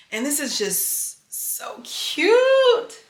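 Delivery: slow, high, delighted gush. Real, not acted. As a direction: coo over how cute it is. Gushing over jacket.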